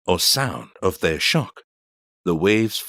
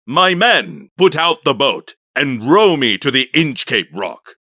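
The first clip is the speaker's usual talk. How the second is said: high, loud order to the crew; commanding captain's call; 5 semitones higher, 6 dB louder than usual. Acted. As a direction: call it out boldly to your men. Pirate captain orders his crew.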